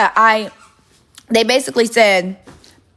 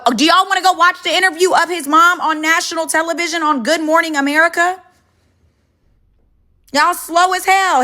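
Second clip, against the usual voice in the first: higher, sarcastic and mocking; sarcastic exasperation; 9 semitones higher than usual. Real, not acted. Mocking chat for not watching the interview, sarcastic and high.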